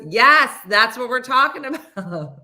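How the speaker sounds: loud, high imitation of an impatient friend; exasperated yes. Friend confirms they mean papaya, exasperated yes. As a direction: burst out with mock impatience.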